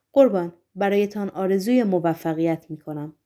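ordinary delivery, nothing marked

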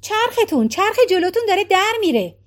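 shouting out the window. Acted. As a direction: call out in alarm from the car window. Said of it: "فریاد زد". (فریاد از پنجره ماشین)

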